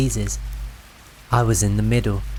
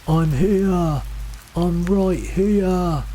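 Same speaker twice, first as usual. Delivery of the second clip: high, long-drawn distant call; 7 semitones higher, much slower than usual. A narrator's reading. Lost voice guiding hikers through fog, calling out. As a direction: stretch the words so searchers can follow.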